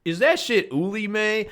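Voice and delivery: high-pitched questioning of mom; acted high, doubting question; incredulous kid. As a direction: pipe up in disbelief like a kid.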